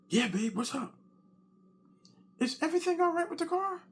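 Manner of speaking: high, quick, worried wife voice; wife's voice. Retold or imitated. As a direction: imitate the wife asking fast. Wife answers from sleep.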